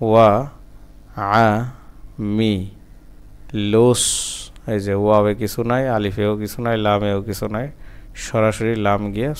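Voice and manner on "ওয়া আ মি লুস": syllable by syllable. Shown as a demonstration. Teacher breaking a word down for learners, syllable by syllable.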